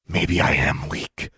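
slow, deliberate musing threat; slow menace. A narrator's reading. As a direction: draw it out over a helpless rival. Menacing a wounded rival.

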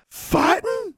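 very high rising question; high-pitched incredulous echo; shocked echo. A narrator's reading. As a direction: squeak it back in disbelief. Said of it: "Constance and Forbearance echoed together".

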